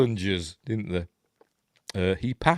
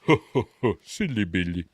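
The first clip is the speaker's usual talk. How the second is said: mock chuckled catchphrase; hearty ho-ho-ho, put-on voice; Denis Healey. Recalling a politician's famous catchphrase, mock jolly. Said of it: "Famous for going".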